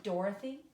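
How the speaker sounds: DOR-o-thy, first syllable stressed, rising end; dactyl stress. Shown as a demonstration. Teacher giving a dactyl example, Dorothy stressed on "Dor". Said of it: "has the same sound".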